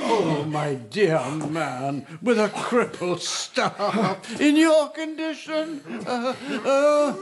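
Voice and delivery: slow, high cackling taunt with rising laughter; mocking laugh. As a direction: sneer at the cripple through laughter. Old man mocks Yukonari's crippled condition.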